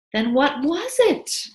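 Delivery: high, loud and slow demand; wife insists on the truth. Acted. Mabel demanding.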